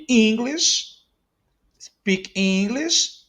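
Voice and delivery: English pitched high with a rising end; stress on English. Shown as a demonstration. Teacher showing where the stress falls, stress on English. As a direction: lean on English, lifting it as a question. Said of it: "Now it's stressing here".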